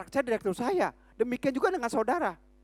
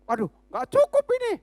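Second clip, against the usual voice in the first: worried housewife; 10 semitones higher, 4 dB louder than usual. Retold or imitated. Housewife complains about gas price.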